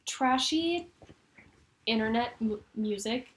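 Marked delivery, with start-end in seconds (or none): 0.1-0.9 high, loud gushing; excited. Real, not acted. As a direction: burst out with excitement. Gushing about trashy internet music.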